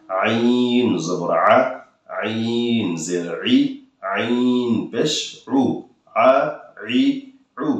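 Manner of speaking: আইন জবর আ drawn out very slowly; slow and pressed. Shown as a demonstration. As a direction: take your time, press the throat. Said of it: "এভাবে পড়বেন". (ধীরে, চাপ দিয়ে আইন)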